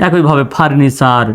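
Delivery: usual speaking voice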